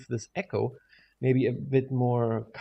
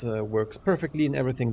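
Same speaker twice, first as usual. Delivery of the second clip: ordinary talk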